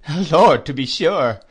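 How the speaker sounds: a loud, high burst of amused recognition; laughing cry. A narrator's reading. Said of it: "cried Traddles, laughing".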